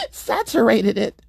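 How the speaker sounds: slow words squeezed through laughter; real laugh breaking into speech. Real, not acted. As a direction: struggle to say it while laughing. Laughing while telling absurd mishap.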